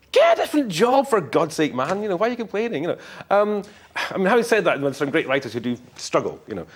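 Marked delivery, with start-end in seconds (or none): 0.1-2.8 high, loud mock outburst; exasperated retort. Writer telling moaning writers off, hammed-up exasperation. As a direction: scold them in exasperation, voice jumping up.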